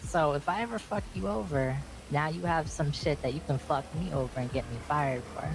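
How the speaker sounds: ordinary talk, nothing marked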